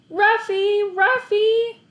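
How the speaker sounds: high, loud repeated shouts; boy shouting desperately for his dog; calling out. A narrator's reading.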